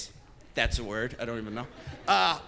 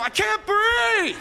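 put-on high whiny kid's yell, much higher; mock yell; 12 semitones higher, much slower than usual. Comedian imitating his little brother yelling out. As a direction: wail it like a little brother faking distress. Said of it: "he'd just go".

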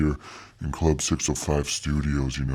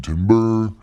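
higher, louder hollered word; mock yell; 6 semitones higher, 5 dB louder than usual. Guest quotes the [name] song to the host, mock yell. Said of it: "I'm yelling".

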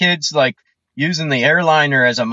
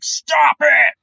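sharp yell; 8 semitones higher than usual. Shown as a demonstration. Showing what the mom should have yelled at her daughter, a sharp shout. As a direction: snap it at a misbehaving toddler. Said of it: "no tone of voice".

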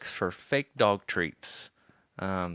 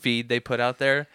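usual speaking voice

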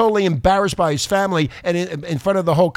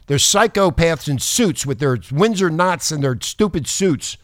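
usual speaking voice

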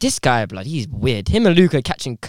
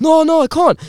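raised-pitch imitation of a stuck friend; mock helpless protest; 8 semitones higher, 4 dB louder than usual.

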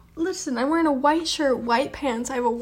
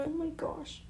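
startled whisper; 3 semitones higher, 10 dB quieter than usual. Real, not acted. Spider found on her.

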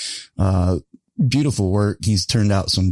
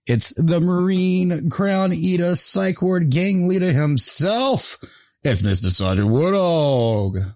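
drawn-out, higher hype-man guest introduction; announcer intro; 8 semitones higher, slower than usual. Acted. Host introducing a guest on the show, slow and hyped. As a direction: roll the guest's name out like a ring announcer.

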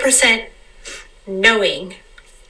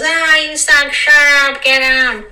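higher, louder mock jeer; heckler; 5 semitones higher, 3 dB louder, slower than usual. Heckler shouting at an actor on stage, mock jeering. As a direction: play a rude heckler in the back row. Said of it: "this heckler comes out and goes".

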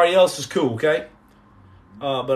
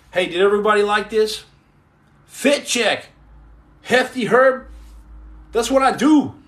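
high, loud hype-man show-off; hyped up; 6 semitones higher, 5 dB louder than usual. Real, not acted. Showing viewers his outfit, loud and hyped. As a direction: sell the fit like a hype man.

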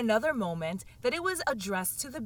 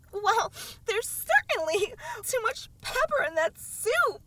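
sneezing; 12 semitones higher than usual. A narrator's reading. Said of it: "as well as she could for sneezing".

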